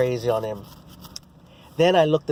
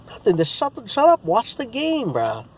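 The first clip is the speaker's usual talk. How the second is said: higher, heated brush-off; annoyed higher snap; snapping back; 10 semitones higher than usual. Retold or imitated. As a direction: cut him off and tell him to watch the game. Heated retort to trash talker.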